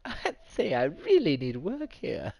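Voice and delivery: loud, helpless amusement at being stuck; laughing. Real, not acted. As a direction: laugh through the words, voice jumping up. Laughing at failing to end stream.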